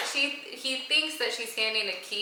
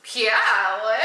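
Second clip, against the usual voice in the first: loud, eager imitation; hammed-up eagerness; mock excited; 9 dB louder than usual. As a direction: burst out as if thrilled. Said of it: "Like, he's excited".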